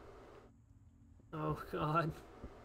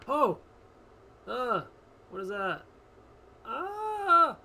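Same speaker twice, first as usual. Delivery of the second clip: startled; 7 semitones higher, 8 dB louder, slower than usual. Real, not acted. Startled by something in the dark.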